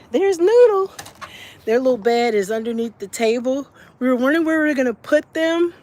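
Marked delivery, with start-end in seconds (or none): 0.1-0.9 excited. Real, not acted. Greeting the puppy by name.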